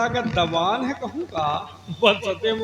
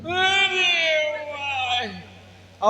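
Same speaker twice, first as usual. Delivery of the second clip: surprised; 6 semitones higher, much slower than usual. Acted. Surprised exclamation.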